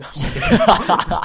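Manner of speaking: loud, slow words through laughter; laughing. Real, not acted. Joking with best friend, laughing. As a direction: crack up while taking it back. Laughs off his own joke.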